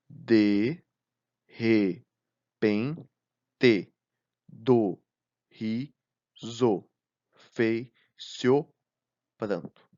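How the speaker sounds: each poetic syllable said apart with a pause. Shown as a demonstration. Teacher scanning the verse aloud for students: slow, separated syllables. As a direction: count the verse out beat by beat. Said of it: "vamos separar as sílabas poéticas".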